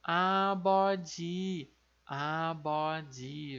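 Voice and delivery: "abode" com B sonoro e final "dji"; coreano abeoji. Shown as a demonstration. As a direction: repita "abode" devagar, B e DJ suaves. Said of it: "Então". (pronúncia coreana de "abode", devagar)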